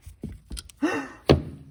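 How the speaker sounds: a breathy high burst of laughter. Real, not acted. Fixing the carb, amused at himself, high breathy laugh. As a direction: laugh at your own bodge. Laughs at own spacing fix.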